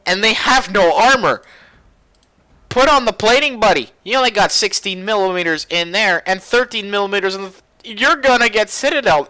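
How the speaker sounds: high, loud, emphatic exclaiming; incredulous warning. Real, not acted. Reviewer warning players there is no armor, incredulous.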